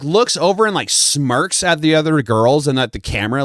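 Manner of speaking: normal talking voice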